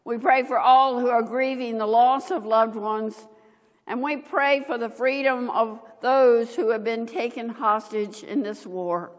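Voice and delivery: ordinary delivery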